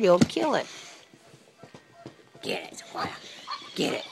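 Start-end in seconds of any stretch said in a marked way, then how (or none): 2.4-4.0 low, hushed urging; whisper. Real, not acted. Owner quietly urging dog after toy, whispered.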